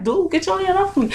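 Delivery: high, quick retold protest; trying to be forceful. Teen telling the officer to let go, acted out trying to be forceful. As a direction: push the demand out fast and high. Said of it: "trying to be real forceful".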